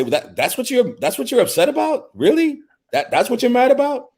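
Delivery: high, incredulous mock voice; mocking disbelief. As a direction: sneer it like a dismissive partner. Mocking partner's dismissive reaction.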